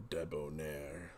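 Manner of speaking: drawn-out, suave debonair; slow, suave Debonair. Shown as a demonstration. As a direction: stretch the word Debonair slowly and smoothly. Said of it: "Is that debonair?".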